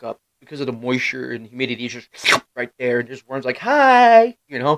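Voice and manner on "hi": high, loud comic little greeting; cheery worm voice. Retold or imitated. Storyteller voicing a worm found under a rock, cheery mock voice.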